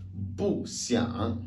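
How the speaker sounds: "bu xiang" drawn out slowly, "bu" falling; unchanged bu. Shown as a demonstration. Teacher shows bu reste tel, slow falling "bu" before "xiang". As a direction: stretch both syllables, dropping "bu". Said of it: "Le bu reste tel".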